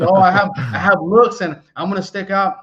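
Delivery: cocky mock confidence. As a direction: puff up like a guy sure he'll stand out. Guy dreaming he'll stand out.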